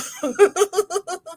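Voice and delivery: high giggle; a run of high giggles; laugh. Real, not acted. Amused at a viewer's comment, high laugh.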